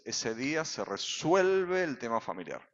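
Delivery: normal talking voice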